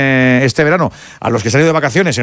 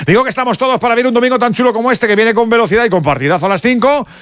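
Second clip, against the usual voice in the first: high, driving radio-host opening; arranque de locutor; 11 semitones higher than usual. Acted. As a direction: open the sports show at full announcer pitch. Presentador anuncia el partido. (arranque de locutor deportivo, agudo y enérgico)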